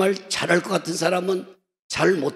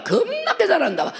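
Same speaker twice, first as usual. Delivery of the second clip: raised, lively Jeolla dialect with a laugh; 14 semitones higher than usual. Retold or imitated. 남성 찬양단을 칭찬하며 웃는 전라도 사투리. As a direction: say it high in a laughing Jeolla accent. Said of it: "전라도 말로".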